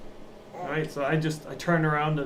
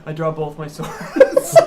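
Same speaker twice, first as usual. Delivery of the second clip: laughing while saying; 7 dB louder, slower than usual. Real, not acted. Player declares action laughing.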